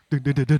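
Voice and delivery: short run of "duk" thuds; imitated bumping wheel; staccato clunks as the car stops; rim clunking noise. Imitates rim rattle while parking.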